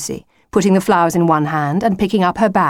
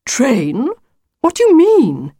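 higher-pitched baffled questions, climbing sharply; puzzled; 9 semitones higher, 5 dB louder, slower than usual. A narrator's reading. Wolf hearing of a train, puzzled. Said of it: "in a puzzled tone".

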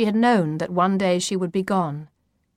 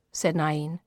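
ordinary delivery, nothing marked

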